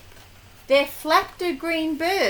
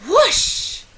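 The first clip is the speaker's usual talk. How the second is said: higher, louder rising whoosh; 6 semitones higher, 5 dB louder than usual. Acted. Broom zooming away, a higher rising whoosh. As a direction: swoop the sound upward.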